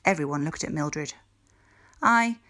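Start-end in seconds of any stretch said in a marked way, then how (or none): 2.0-2.4 tartamudea con voz alta y nerviosa; hesitant. A narrator's reading. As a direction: confiesa dudando, casi con miedo. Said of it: "Mildred said hesitantly".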